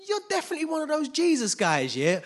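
high, fast shouted accusation; loud accusation. Acted. Bystander cornering Peter as a follower, high rapid shout. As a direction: shout the charge, pitch way up.

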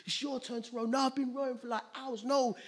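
high-pitched mock squabble; bickering disciples. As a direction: argue back and forth like tired rowers. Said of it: "they could be arguing and everything".

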